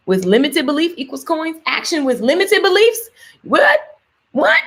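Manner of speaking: puzzled read-aloud climbing into a high "what?"; baffled. Real, not acted. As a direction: read it with rising disbelief, ending sharply high. Baffled reading a viewer's mistyped comment.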